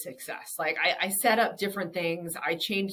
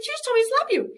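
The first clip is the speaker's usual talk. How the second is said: very high, louder mock protest; incredulous protest; 7 dB louder, faster than usual. Slapper defending herself to her stunned friend, incredulous. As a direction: squeal it in disbelieving self-defense.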